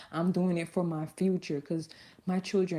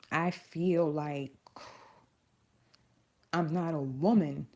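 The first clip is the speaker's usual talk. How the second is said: holding back tears. Real, not acted. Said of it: "I told you I didn't wanna cry".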